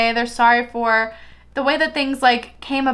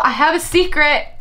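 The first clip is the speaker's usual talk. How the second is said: ordinary delivery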